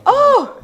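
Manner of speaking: loud, high "oh!" of dismay; high mock-horrified yelp; horrified gasp. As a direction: cry out as if you've just blundered. Retelling a horrified reaction.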